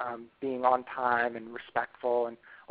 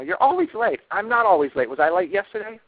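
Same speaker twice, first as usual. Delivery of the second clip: mock defensive snap, higher and louder; acting out the defensive partner, raised voice; defensive retort; 7 semitones higher, 9 dB louder than usual. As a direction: push back at the accusation. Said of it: "You don't get defensive about".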